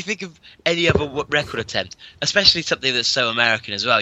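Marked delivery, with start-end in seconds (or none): none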